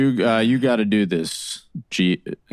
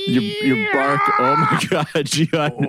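high amazed shriek; 10 semitones higher than usual. Acted. Amazed at friends vibrating.

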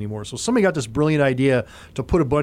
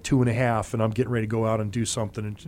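ordinary talk, nothing marked

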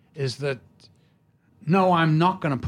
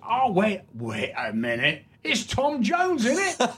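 put-on cockney drunk; 9 semitones higher than usual. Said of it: "then he goes".

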